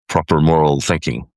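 ordinary talk, nothing marked

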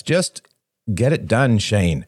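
mildly exasperated push; impatient. A narrator's reading. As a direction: hurry him along, a touch testy. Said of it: "said Robert impatiently".

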